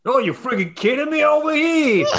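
high-pitched put-on indignation; mock outrage. Speaker riffing on stock indignant phrases, mock outraged. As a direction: squeal it in comic disbelief.

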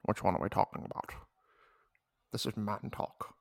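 a hint of a smooth British spy; James Bond voice. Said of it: "do James Bond".

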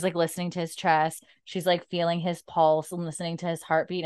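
ordinary talk

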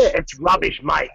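hammed-up Australian accent; Australian accent on request. Shown as a demonstration. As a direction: lay on the Aussie vowels in rubbish and mate. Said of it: "Say, say rubbish".

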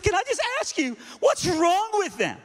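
high, loud, imitated wailing question; exasperated disbelief. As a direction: drag out the last phrase, overwrought. Astonished lady demands an explanation.

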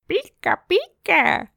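squeaky high "Pika, pika" with falling end; high Pikachu voice. A narrator's reading. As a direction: chirp it like a tiny Pokémon. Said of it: "in een hoog stemmetje". (hoog Pikachu-stemmetje)

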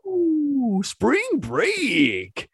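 high whooping party cry; hillbilly whoop. Acted. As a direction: whoop like a rowdy college kid. Voices hillbilly character again.

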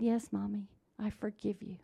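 very soft, lightly voiced child; retold soft forgiveness. Little girl forgiving Mommy, imitated soft reply. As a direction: answer softly as a forgiving child.

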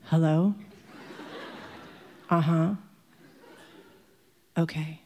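low, sparse mock phone replies; subdued phone answers. Indignant father blanches taking a call, acted subdued low replies.

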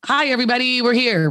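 mock perky host opening the show; on-air cheer. Shown as a demonstration. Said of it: "I still have to get on".

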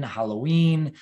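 ordinary talk

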